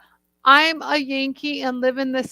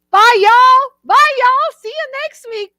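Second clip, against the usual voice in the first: put-on Southern accent; 11 semitones higher, 6 dB louder than usual. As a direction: holler goodbye in a high Southern twang.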